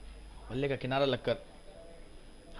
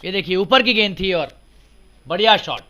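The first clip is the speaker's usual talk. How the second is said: loud, high call of a boundary four; excited cricket commentary; 7 semitones higher, 13 dB louder than usual. Real, not acted. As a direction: build to a shout as it crosses the rope.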